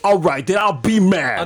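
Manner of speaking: exaggerated mad act. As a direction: triple down on mock anger. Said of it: "He just triples down on it".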